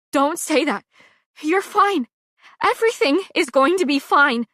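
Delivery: high, loud desperate insistence; tearful denial. Acted. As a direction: refuse the truth through tears. Denying friend is dying.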